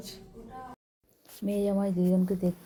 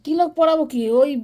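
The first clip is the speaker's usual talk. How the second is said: loud, high question; to someone hard of hearing; 6 semitones higher, 8 dB louder than usual. Real, not acted. Loud reply for grandma.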